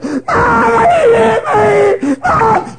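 put-on high wailing child tattling; hammed-up bawl, drawn out; crying little Susie. Little Susie running in to tell Mom, wailing. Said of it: "she comes in there and she's crying".

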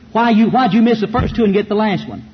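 ordinary delivery

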